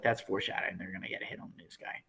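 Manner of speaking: whispered knowing aside, quick; hushed aside. Real, not acted. Friend whispering a plot prediction to cohost, hushed.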